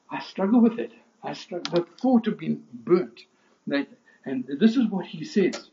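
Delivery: high, loud, driving pulpit proclamation; preaching at full force. Acted. Preacher to his congregation, at full force. Preacher confessing, then rising.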